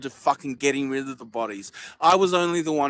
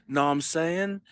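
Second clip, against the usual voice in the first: ordinary delivery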